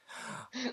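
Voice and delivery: hammed-up high gasp; mock gasp, high. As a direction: gasp like she found treasure, overplayed. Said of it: "and then it would be like".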